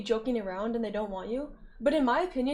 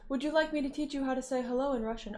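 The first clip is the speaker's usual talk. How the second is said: ordinary talk, nothing marked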